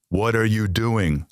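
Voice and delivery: spelled out slowly, each word separate; slow deliberate repeat. Retold or imitated. Son asks father a third time, slowly and deliberately. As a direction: drag it out as if to the hard of hearing.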